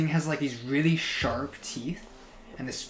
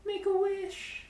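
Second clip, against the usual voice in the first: squeaky creature voice; slower than usual. Acted. GM voices monster high.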